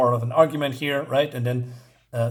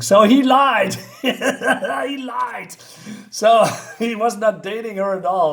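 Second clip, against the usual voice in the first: real laugh through the words, louder; 7 semitones higher, 5 dB louder, slower than usual. Real, not acted. Loud laughing. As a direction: recapper laughing at the dog's lie.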